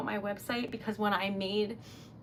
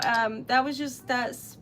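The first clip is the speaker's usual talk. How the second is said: ordinary talk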